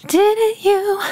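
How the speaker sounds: high falsetto, tossed over the shoulder; demented falsetto. Retold or imitated. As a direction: sing it up high in a thin, slightly off falsetto. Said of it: "It's in his falsetto".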